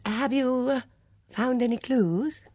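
high, hesitant question; vague fluttery woman. A narrator's reading. Flustered witness questioning the inspector, high and hesitant. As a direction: ask haltingly and timidly. Voices flustered Miss Murgatroyd.

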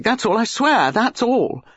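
high, emphatic protest; desperate insistence. A narrator's reading. Basil denies knowing the girl well, desperate insistence. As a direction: swear it desperately. Basil swears his innocence.

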